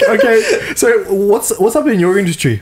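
lightly voiced chatty friend. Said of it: "He'd be like".

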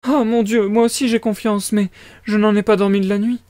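high, distressed mother; crying out. A narrator's reading. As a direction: wail it lightly as a worried mama. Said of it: "s'écria la pauvre femme".

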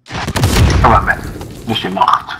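breathy, deflated sigh of defeat; resigned whisper. Real, not acted. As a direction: let it go, beaten. Just got killed in game. (sussurro rassegnato)

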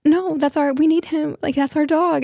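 high, alarmed retelling of the husband's panic; mock panic, voice climbing high; panicked husband. Husband panics at dog drop-off.

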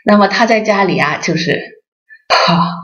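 ordinary talk

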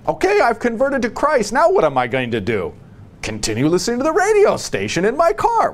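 high-pitched mock-earnest convert; exaggerated, high imitation of a new convert. Voices a hypothetical new convert.

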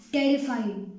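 ordinary delivery, nothing marked

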